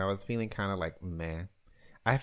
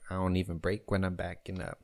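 ordinary talk, nothing marked

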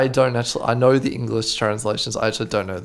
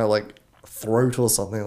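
ordinary talk, nothing marked

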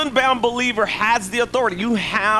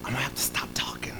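unvoiced whisper, much softer; 7 semitones lower, 14 dB quieter than usual. Shown as a demonstration. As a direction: barely breathe the words out. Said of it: "I sound like, like I'm whispering".

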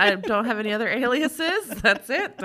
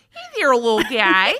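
hammed-up Midwestern accent, louder; mock Midwestern greeting to a little guy; 8 dB louder than usual. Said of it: "I always do a Midwestern accent".

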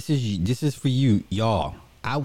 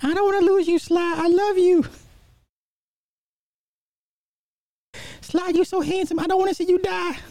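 man doing a woman's voice. Retold or imitated. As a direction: pitch way up into a swooning woman. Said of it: "my girl voice sucks".

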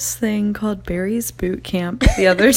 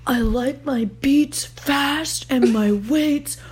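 higher gym bro, ending in a shout; gym bro hype voice; 6 semitones higher, slower than usual. Retold or imitated. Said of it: "he's screaming".

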